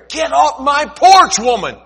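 loud, high, slow angry yell; judge shouting. Acted. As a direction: yell her off the porch. Judge yelling at widow.

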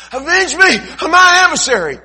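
high, loud nagging widow voice; pleading widow. Acted. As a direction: pester the judge like an insistent widow. Widow at window.

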